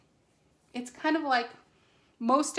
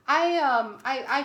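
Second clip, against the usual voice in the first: stuttering; 5 semitones higher, 10 dB louder than usual. Real, not acted. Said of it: "I'm sorry if I'm stuttering".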